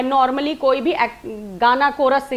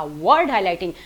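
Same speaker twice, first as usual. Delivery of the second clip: Word pressed harder than highlighting; stress on word; 6 semitones lower than usual. Shown as a demonstration. Teacher showing learners where the stress falls, stress on word. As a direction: lean on word, the rest light. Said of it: "word पे मेरा एक emphasis था".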